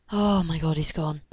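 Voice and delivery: normal talking voice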